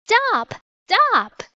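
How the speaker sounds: very high, contained yell; narrator's high-pitched yell, little added volume. A narrator's reading. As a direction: call high after someone leaving. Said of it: "they both yelled".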